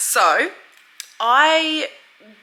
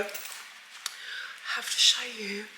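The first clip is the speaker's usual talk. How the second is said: whisper; 9 dB quieter, much slower than usual. Real, not acted. Secret reveal of full outfit.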